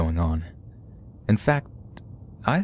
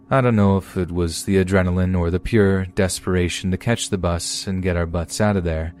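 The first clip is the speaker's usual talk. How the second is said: usual speaking voice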